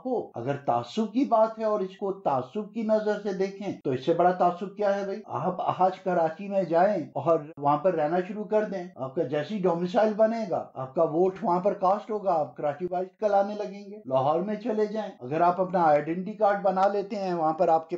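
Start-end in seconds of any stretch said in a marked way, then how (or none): none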